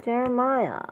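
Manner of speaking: a high scolding call of the name. Acted. Calling Jeremiah twice, differently.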